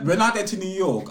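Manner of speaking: ordinary talk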